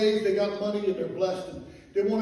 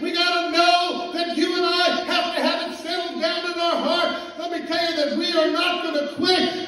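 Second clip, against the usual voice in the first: fiery preaching; 8 semitones higher, 6 dB louder than usual. Acted. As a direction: hammer it home to the church. Preaching, congregation answers amen.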